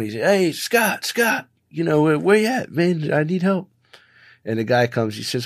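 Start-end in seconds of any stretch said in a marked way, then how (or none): 0.1-3.7 higher-pitched mock call from down a hole; retold yell for help. Man stuck in hole calling to Scott, higher mock yell. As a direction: call up high for your friend, half-acted. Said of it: "he's yelling".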